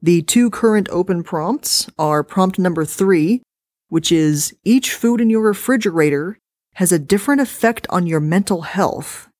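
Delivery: plain reading voice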